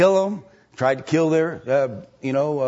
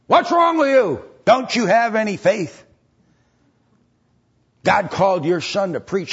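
much higher, louder rebuke; scolding wife; 7 semitones higher, 4 dB louder than usual. Retold or imitated. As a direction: scold the husband hard. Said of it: "Missus Schuler said".

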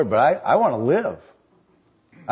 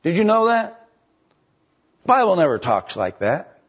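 preacher's challenge; 5 semitones higher than usual. Acted. Preacher questioning the flock.

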